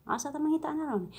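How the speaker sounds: ordinary talk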